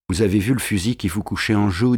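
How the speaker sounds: ordinary delivery, nothing marked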